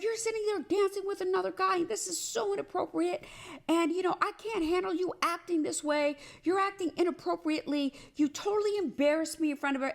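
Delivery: high, quick mocking whine of his scolding; jealous boyfriend rant. She mimics her boyfriend's jealous rant about her dancing, high and fast. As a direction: imitate him lecturing her, petulant and fast.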